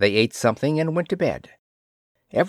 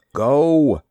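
a sharp, louder order to go; firm command; 3 semitones higher, 6 dB louder than usual. Acted. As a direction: snap it out as a demanding wife. Wife orders husband to go.

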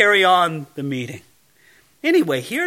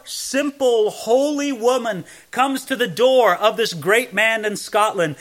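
ordinary talk

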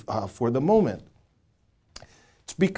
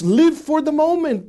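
higher, upbeat mock slogan; carefree YOLO believer; 13 semitones higher, 5 dB louder than usual. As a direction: voice the live-for-today crowd. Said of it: "they would say".

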